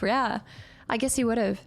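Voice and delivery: ordinary talk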